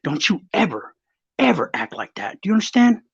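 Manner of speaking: retold stern grandma, rising sharply at the end. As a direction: lecture the grandson firmly. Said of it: "she goes".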